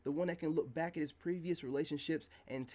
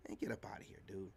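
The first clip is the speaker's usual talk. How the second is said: whispered get out of here, much lower and softer; dismissive whisper; 7 semitones lower, 11 dB quieter than usual. Real, not acted. Waving off the critic, a soft whisper. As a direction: breathe it out under your voice, done with them.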